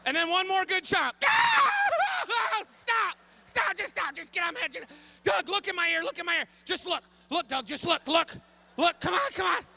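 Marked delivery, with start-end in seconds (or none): 1.2-2.6 shrill loud yelp, much higher; high panicked cry. Retold or imitated. Bug chomps, panic. 2.7-9.6 begging Doug to look in his ear; panicked pleading. Retold or imitated.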